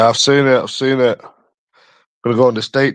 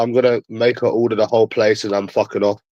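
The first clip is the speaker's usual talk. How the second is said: ordinary talk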